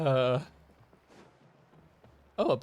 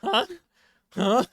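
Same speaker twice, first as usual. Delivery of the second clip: surprised laugh; 11 semitones higher, 9 dB louder than usual. Real, not acted. Surprised at sigil count.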